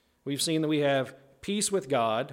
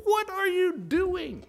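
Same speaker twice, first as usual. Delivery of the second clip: put-on shocked kid; 3 dB louder, slower than usual. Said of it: "I'd be like".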